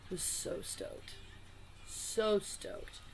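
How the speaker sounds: slow, whispered awe; awed whisper. Real, not acted. Vlogger awed by the Poe card, whispering slowly. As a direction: breathe it out in wonder.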